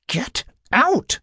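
high-pitched, angry order; two separate, high commanding words. A narrator's reading. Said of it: "Sarah commanded".

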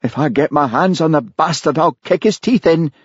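mild bitter threat; a touch of bitter anger; bitterly. A narrator's reading. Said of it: "said Charlie bitterly".

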